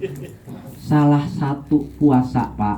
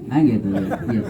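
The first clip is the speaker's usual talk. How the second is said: normal talking voice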